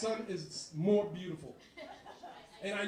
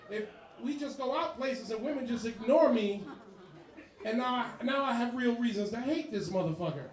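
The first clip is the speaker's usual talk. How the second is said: usual speaking voice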